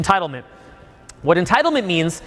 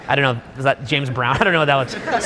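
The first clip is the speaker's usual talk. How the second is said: usual speaking voice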